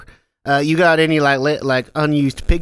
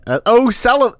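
put-on Western accent; 7 semitones higher, 4 dB louder than usual. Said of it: "hard thing to say in an accent".

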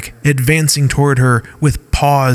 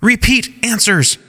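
high call to the cave; 10 semitones higher than usual. Acted. Skyla calls out to the learning cave, commanding. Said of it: "calling out to the cave".

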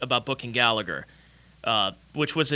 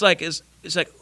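normal talking voice

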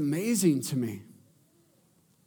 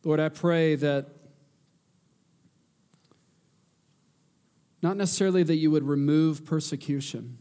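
earnest pleading prayer; 3 semitones lower than usual. Real, not acted.

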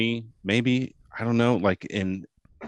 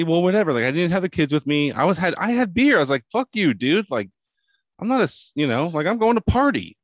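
defiant outburst; 7 semitones higher, 5 dB louder than usual. Real, not acted. Defiantly insisting he's not old.

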